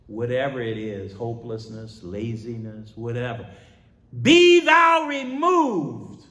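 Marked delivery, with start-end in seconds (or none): 4.2-6.2 loud, very high, drawn-out command; commanding shout. Acted. Preacher ordering inner mountains gone, commanding shout. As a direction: cry it out high and slow, stretching each word.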